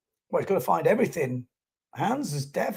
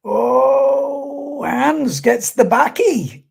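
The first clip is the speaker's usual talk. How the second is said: excited; 8 semitones higher, 6 dB louder, much slower than usual. Real, not acted. Rider lands a back loop.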